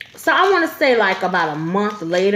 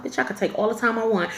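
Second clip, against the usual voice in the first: usual speaking voice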